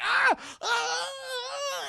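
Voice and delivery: panicked screams. Acted. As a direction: scream while fighting off. Doll biting her.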